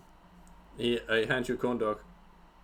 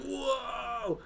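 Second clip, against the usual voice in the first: impressed crowd; 14 semitones higher, 3 dB quieter than usual. Retold or imitated. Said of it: "people are like".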